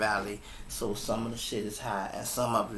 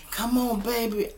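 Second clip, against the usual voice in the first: high-pitched coaxing imitation; coaxing father; 11 semitones higher, 4 dB louder than usual. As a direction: coax a small child toward you, voice up high. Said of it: "he trying to coach her".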